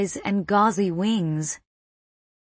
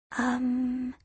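put-on thinking little girl; 4 semitones higher, 8 dB quieter than usual. A narrator's reading.

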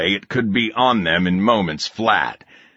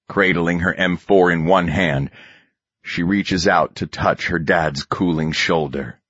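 ordinary delivery, nothing marked

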